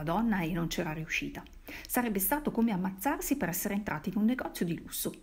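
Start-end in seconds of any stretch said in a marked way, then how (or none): none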